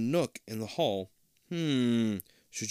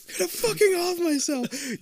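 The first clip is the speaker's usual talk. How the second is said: high-pitched laughing repeat; 4 dB louder than usual. Pretend. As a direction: laugh through the line.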